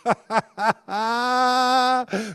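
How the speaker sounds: high hearty mock laugh; high imitated laughter; angels laughing. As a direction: laugh like carefree angels round a fire. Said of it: "they're laughing".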